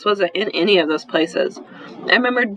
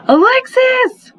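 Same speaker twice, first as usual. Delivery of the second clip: long, drawn-out yell, much higher and louder; yelling a name; 5 dB louder, much slower than usual. Acted. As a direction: call her name out slowly across the house. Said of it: "I yelled".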